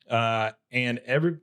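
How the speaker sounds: usual speaking voice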